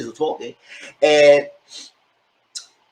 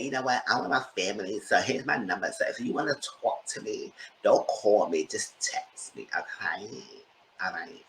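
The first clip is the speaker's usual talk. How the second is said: soft hushed voice; 6 semitones lower, 11 dB quieter than usual. Retold or imitated. Stephanie's caller voice, hushed.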